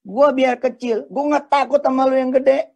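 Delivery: slow, high, loud defiant brag; defiant boast. Shown as a demonstration. Little guy boasting to the big guy, loud and defiant. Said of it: "Terus kita ngomong begini".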